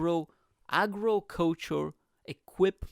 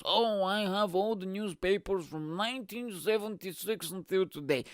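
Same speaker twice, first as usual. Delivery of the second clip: higher, put-on weirdo voice; mocking newspaper collector; 4 semitones higher than usual. Comic mocking newspaper collectors, in a higher put-on voice. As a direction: mimic a proud hoarder, pitched up. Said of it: "those fucking weirdos".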